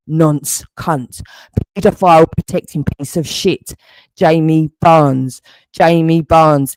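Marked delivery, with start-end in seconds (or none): 4.2-6.7 louder, slow, deliberate calling of the name; slow loud naming. Real, not acted. Man calls out his accuser's name, slow and loud. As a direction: call out his name slowly like a warning.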